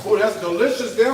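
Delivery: ordinary talk, nothing marked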